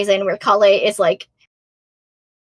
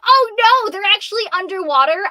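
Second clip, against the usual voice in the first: screaming; 9 semitones higher, 4 dB louder than usual. Retold or imitated. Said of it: "I screamed that at the top of my lungs".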